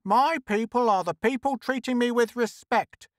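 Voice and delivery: high, indignant comeback; haughty high-voiced rebuff; retorting. A narrator's reading. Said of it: "she retorted".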